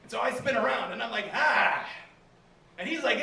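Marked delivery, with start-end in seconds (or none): 1.3-1.8 short, loud, high startled "Ah!"; startled yell. Retold or imitated. Comic spinning round at an attacker, startled yell. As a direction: yelp as you spin round to fight.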